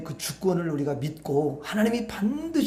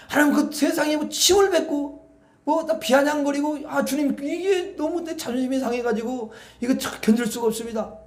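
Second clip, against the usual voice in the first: high, pleading whine to God; a raised, aggrieved plea for lightning; mock complaining prayer; 7 semitones higher than usual. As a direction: play an offended man begging for revenge. Voicing an imagined complaining prayer.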